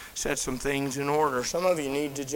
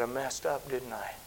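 much softer, broken admission; retold, quiet tearful voice; tearful confession; 2 semitones lower, 9 dB quieter than usual. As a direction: say it like a man near tears. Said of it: "he started crying".

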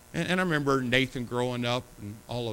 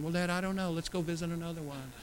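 lightly voiced daughter, higher and softer; daughter's voice; 4 semitones higher, 5 dB quieter than usual. A hint of daughter coaxing dad. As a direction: imitate a coaxing girl. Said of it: "she just kept saying".

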